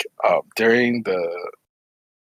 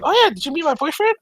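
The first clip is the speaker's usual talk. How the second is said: high, chirpy retold question; imitated bubbly friend. Friend introducing her boyfriend, imitated in a high chirp. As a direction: imitate a friend perking up, pitched way up.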